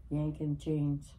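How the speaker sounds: usual speaking voice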